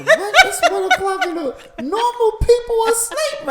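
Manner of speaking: high, laughing mock protest; mock-indignant laugh. Husband mimicking his sleepy protest to his wife, laughing.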